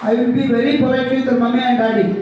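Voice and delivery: slow, drawn-out chanted pledge; slow group recitation. Real, not acted. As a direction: recite the promise word by word. Class reciting a promise together.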